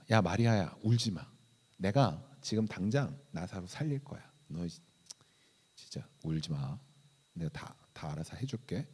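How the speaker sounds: low, soft, offhand comforting; easy, understated "I've got this" tone; casual reassurance. Pretend. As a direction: play at soothing someone crying, voice dropped and quiet. Imagines himself comforting weeping Mary.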